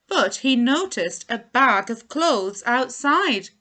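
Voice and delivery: plain reading voice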